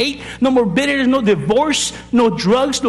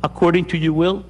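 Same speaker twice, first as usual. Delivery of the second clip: led prayer; 4 semitones lower than usual. Acted. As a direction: pray it line by line so they can follow. Leads the sinner's prayer.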